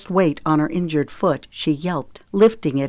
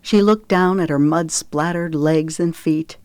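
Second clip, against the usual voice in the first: usual speaking voice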